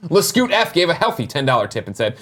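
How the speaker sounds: normal talking voice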